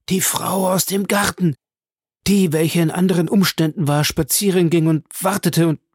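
high, urgent recollection; agitated alarm. A narrator's reading. Bertuccio recalls the woman from the garden, agitated. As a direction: blurt it out, aghast.

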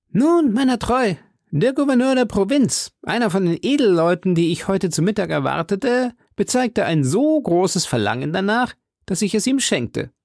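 high, put-on character voice; hearty musketeer host. Host explains away his missing horse, high voice. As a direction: justify yourself in a high, lively voice. Porthos voiced in story dialogue.

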